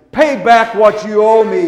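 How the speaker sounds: loud, harsh demand; an angry servant demanding his money. Retold or imitated. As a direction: bark the demand at the debtor. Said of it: "he demanded".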